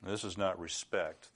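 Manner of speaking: ordinary talk, nothing marked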